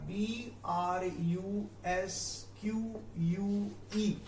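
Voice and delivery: letters spelled out slowly one by one; slow spelling. Shown as a demonstration. Teacher spelling the word slowly for students. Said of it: "spelling out the word".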